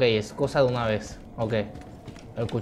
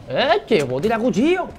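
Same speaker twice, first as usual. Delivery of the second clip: much higher and louder, thrilled; excited shout; 10 semitones higher, 5 dB louder than usual. Real, not acted. Gamer thrilled he can throw the knife, shouting. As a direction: yell it like a sudden discovery.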